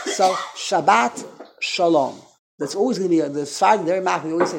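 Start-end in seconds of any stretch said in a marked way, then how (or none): 0.5-2.2 slow "Shabbat Shalom", open A and end stress, sha-BAT sha-LOM. Shown as a demonstration. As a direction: stretch it out, "Shabbat" with a final T. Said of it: "always turns into a patach".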